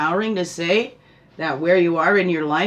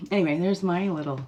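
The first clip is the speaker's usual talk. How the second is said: ordinary delivery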